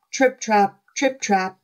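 usual speaking voice

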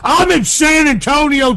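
high, loud exasperation; exasperated shout. Real, not acted. Caller correcting the pet store clerk, exasperated shout. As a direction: yell it at someone who isn't getting it.

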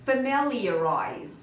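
FAMILIARIZE drawn out very slowly; slow model pronunciation. Shown as a demonstration. Teacher asks students to repeat after her, slow model pronunciation. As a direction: stretch "Familiarize" for learners to repeat. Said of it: "try to repeat after me".

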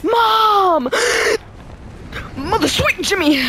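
high, loud startled yelp; panicked yelp. Real, not acted. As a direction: cry out in sudden fright. Panicked fall in game.

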